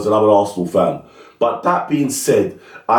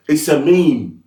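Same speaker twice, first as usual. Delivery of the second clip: usual speaking voice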